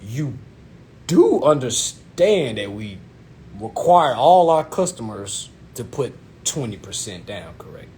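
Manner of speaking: slow, halting, careful explanation; imitating the salesman. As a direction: spell it out piece by piece with pauses. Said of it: "This is exactly how he said it".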